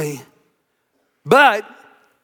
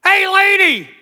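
cheeky parrot heckle, pitched way up; squawking parrot; 7 semitones higher than usual. Acted. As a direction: call out in a high-pitched bird voice. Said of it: "the parrot would go".

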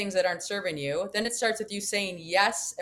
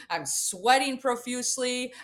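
ordinary delivery

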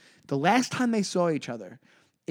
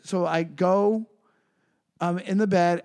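ordinary delivery

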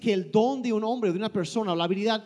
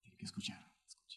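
breathy whisper, barely there; 23 dB quieter, faster than usual. Acted. Interpreter echoing the preacher's hush in a whisper. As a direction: whisper it back like a secret.

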